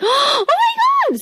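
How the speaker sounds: high, shouted "Oh my God!"; shocked yell at the dog singing; surprised shout. Retold or imitated. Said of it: "And I was like".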